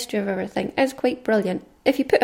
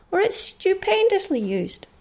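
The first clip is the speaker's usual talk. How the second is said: excited; 10 semitones higher, slower than usual. Real, not acted. Enthusing about film.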